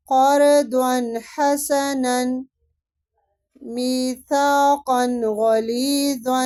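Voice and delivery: tajweed recitation. Shown as a demonstration. Teacher recites Quranic examples for students, slow and melodic. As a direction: recite each word drawn out and melodic. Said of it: "را کو باریک پڑھتے ہیں".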